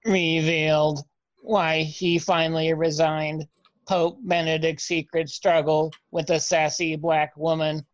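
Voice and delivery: even, monotone robot. Acted. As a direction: read it like a machine, all on one pitch. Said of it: "your accent is robot".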